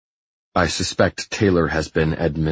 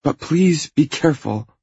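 high, urgent plea; pleading; 11 semitones higher, 4 dB louder than usual. Acted. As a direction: plead with a strong push on please.

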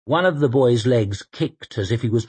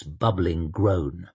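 ordinary delivery, nothing marked

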